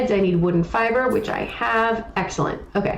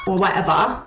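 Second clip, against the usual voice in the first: mocking whatever; dismissive whatever, like Owl; imitating Owl. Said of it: "He says things like that".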